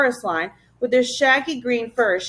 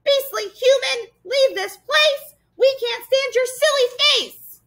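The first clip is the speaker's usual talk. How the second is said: high-pitched jeering monster shriek; shrieking creature taunts. A narrator's reading. Furry monsters driving intruders away, shrieking. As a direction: screech insults like a pack of nasty little beasts. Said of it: "They were shrieking and skulking".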